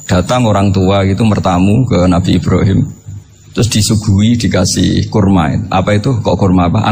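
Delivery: usual speaking voice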